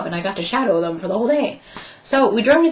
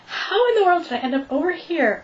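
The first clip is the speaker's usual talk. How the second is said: high, marvelling disbelief; retold disbelief; 8 semitones higher than usual. Retelling the interview, high amazed marvelling. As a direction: wonder aloud at the coincidence. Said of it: "I'm just like".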